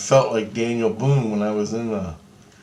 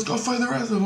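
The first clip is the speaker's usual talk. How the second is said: high-pitched quoted command; urgent order; 13 semitones higher, 3 dB quieter, faster than usual. Retold or imitated. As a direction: voice someone urging a fighter on, high. Voices Christ repeating the order.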